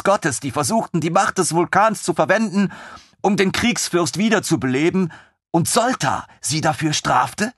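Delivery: put-on high, excited young officer; lieutenant thrilled by the idea; eager lieutenant. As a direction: bubble over with enthusiasm.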